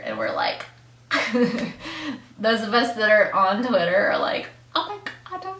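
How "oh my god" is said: high squealing disbelief; excited squeal. Retold or imitated. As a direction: squeal it like a starstruck fan. Fans reacting, squealing disbelief.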